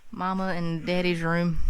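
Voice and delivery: normal talking voice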